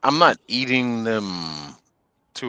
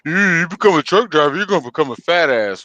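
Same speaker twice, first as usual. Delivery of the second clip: higher, louder, sneering mimic; 7 semitones higher, 6 dB louder than usual. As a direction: parrot the know-it-all taunt. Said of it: "I hate hearing that stereotype of".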